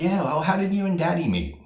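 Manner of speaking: high, mocking little kid asking about her parents; mock child voice. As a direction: pitch it up like a curious kid, teasing. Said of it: "you're gonna say".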